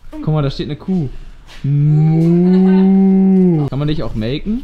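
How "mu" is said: loud, high drawn-out moo; cow moo. Pretend. Mooing at the horse. (lautes, langgezogenes Muhen)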